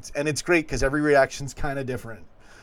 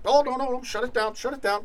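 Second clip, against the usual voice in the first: mock panicking rival fans; 10 semitones higher than usual. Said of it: "they're like".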